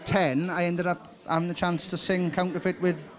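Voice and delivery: normal talking voice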